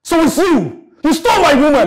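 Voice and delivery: loud, high, raging shout; furious accusation. Acted. Betrayed man confronts his rival over his woman, raging shout. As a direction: hurl the accusation at him.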